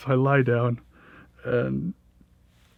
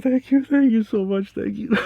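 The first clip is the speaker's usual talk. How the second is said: high, loud gushing gratitude; overwhelmed thanks; 11 semitones higher, 7 dB louder than usual. Retold or imitated. Naked tripper hugs the shaman and cousin, gushing loud high thanks. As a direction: pour out thanks, pitched way up and loud. Hugging rescuers in tears.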